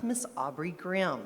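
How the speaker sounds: ordinary talk, nothing marked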